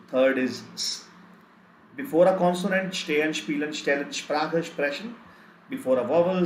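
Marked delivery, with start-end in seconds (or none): 0.7-1.1 "s" as a sharp voiceless hiss; hissed s sound. Shown as a demonstration. Teacher recaps three S sounds, third a hissed "s". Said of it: "Three sounds of S". 2.9-5.1 "stehen, spielen, stellen, Sprache, sprechen" with sh onsets. Shown as a demonstration. Teacher lists consonant examples in recap, each with initial sh. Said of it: "One is sh".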